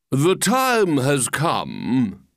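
very slow, grand proclamation; pompous walrus. Acted. Walrus opens his speech to the oysters, pompously. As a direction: stretch each word out like an orator. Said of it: "the walrus said".

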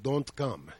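lightly put-on Russian accent. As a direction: imitate an aunt's Russian voice. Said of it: "in that Russian voice".

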